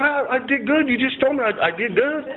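high-pitched, stammering plea; pleading protest. Retold or imitated. Defendant protests the judge's refusal, pleading.

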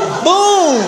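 excited boom. Acted. Triumphant exclamation in story.

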